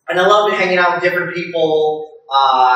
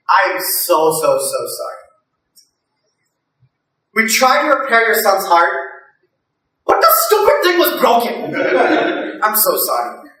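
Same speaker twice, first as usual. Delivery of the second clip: dad as surgeon; 4 semitones higher than usual. Pretend. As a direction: deliver bad news like the dad's excuse. Dad as surgeon telling parents.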